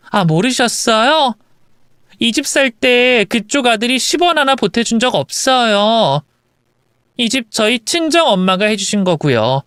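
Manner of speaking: lightly acted triumphant comeback; spring the facts on her; smug wife. Wife revealing the house is hers, smug.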